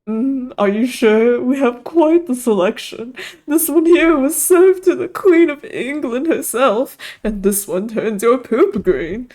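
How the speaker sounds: high, slow, hammed-up posh waiter; posh accent. Fancy waiter pitching trays to a friend, posh accent. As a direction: lay the posh accent on thick, drawing words out. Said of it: "in my best posh accent".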